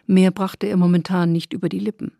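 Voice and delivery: plain reading voice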